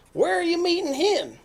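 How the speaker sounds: loud, high fox question; alarmed fox. Acted. Fox asks mouse about meeting Gruffalo, loud and high.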